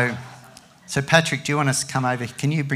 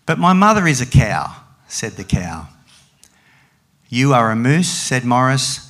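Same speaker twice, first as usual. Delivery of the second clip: animated picture-book reading with raised, loud dialogue; story reading to a child; 6 dB louder than usual. Acted. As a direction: perform the animals' argument, drop for the tags. Reads picture book aloud.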